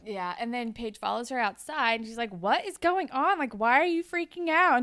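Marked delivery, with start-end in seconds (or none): 2.3-4.8 higher, louder mock-dramatic questioning; exasperated demand. Recapper voices Paige confronting her upset friend, exasperated demand. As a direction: act out a confused, urgent confrontation. Acted confrontation, retelling episode.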